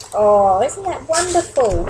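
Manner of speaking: slow, tender happy remark; warm delight. Real, not acted. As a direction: share the happy ending. Reader delights.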